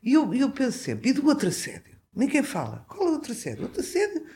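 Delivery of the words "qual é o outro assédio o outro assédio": laughing. Real, not acted. Laughing at her own point.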